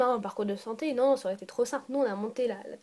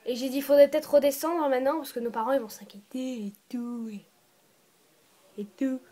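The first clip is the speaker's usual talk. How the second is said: whisper. Real, not acted.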